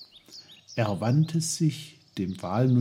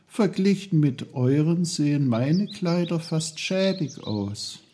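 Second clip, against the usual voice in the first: put-on lightly raised, curious boy's voice; a hint of a higher, friendly boy; young Peter, eager; 7 semitones higher than usual. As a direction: ask it politely like a boy talking to trees.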